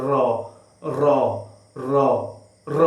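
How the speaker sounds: র trilled with the tongue tip; tongue drill; rolled r. Shown as a demonstration. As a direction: flick the tongue to roll র. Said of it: "জিহ্বাটাকে ... নাড়াতে হবে".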